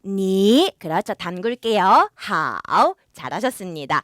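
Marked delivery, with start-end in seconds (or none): 0.0-0.7 니 rising straight up like a question; second-tone demonstration. Shown as a demonstration. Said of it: "그 상태로". 2.1-2.9 long 하오 sinking low, then rising; third-tone demonstration. Shown as a demonstration. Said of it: "삼성이에요".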